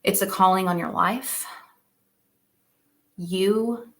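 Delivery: ordinary talk, nothing marked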